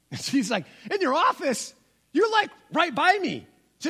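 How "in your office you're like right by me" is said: incredulous daughter. Retold or imitated.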